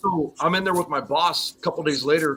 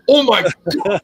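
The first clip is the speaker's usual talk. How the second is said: shocked; 5 semitones higher, 7 dB louder, much slower than usual. Retold or imitated. Secretary sees the flattened finger.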